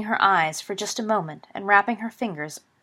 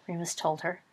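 ordinary delivery

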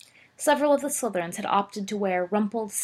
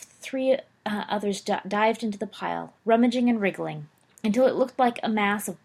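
plain reading voice

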